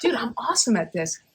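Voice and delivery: ordinary delivery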